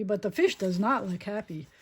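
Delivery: usual speaking voice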